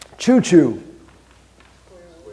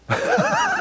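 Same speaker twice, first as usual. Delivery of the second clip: high burst of laughter. Real, not acted. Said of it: "You were right".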